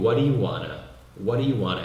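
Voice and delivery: "What do you wanna" linked as "waddayu-wanna"; casual native-style linked "What do you wanna"; words run together. Shown as a demonstration. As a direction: slur "What do you" into one quick "waddayu" before "wanna". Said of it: "Repete comigo".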